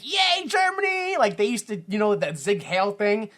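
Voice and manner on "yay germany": high, slow mock cheer; cheering Germans. Mocking Germans cheering, high and slow. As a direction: cheer it like a happy crowd. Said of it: "They were like".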